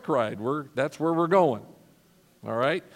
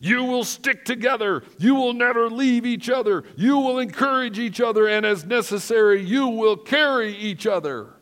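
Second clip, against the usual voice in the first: higher, louder sergeant laying down the rules; drill sergeant orders; 7 semitones higher, 6 dB louder than usual. Retold or imitated. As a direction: bark each rule at the rangers. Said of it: "he growled".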